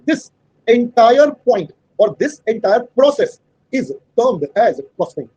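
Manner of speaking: very slow, word by word dictation; dictating a definition to students. Shown as a demonstration. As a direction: spell it out slowly for notes. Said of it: "teacher dictates definition".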